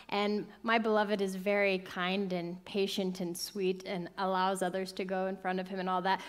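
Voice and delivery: usual speaking voice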